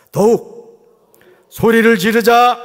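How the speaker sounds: loud, ringing chant for the crowd; loud call to repeat. Shown as a demonstration. Pastor leading the church in a loud chant. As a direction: lead the congregation in a shout. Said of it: "따라합시다".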